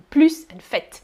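PLUS with a hissed S, FAIT with a clear T; final consonants sounded. Shown as a demonstration. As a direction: say "plooss" and "fett". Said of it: "pronouncing final consonants".

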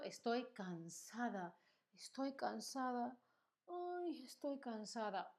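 soft, drained sigh of a tired woman. Shown as a demonstration. Teacher acting out being tired for her class, softly weary. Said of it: "hoy no he dormido".

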